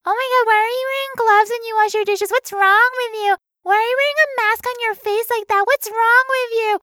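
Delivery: high-pitched mock-shocked coworkers; high, appalled busybody imitation; nosy office workers. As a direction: pester in a high, judgmental office-gossip voice. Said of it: "hundred office workers who were like".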